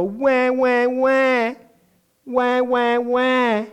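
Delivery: put-on high babyish wah wah crying; hammed-up crybaby wail; mock whining cry. Mocking whiny believers in trials.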